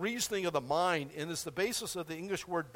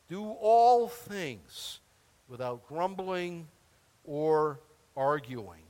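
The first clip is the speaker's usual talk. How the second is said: very slow, each phrase set apart; slow emphatic reading; 4 dB louder, much slower than usual. Shown as a demonstration. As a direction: read it out slowly, weighing every phrase. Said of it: "slow emphatic scripture reading".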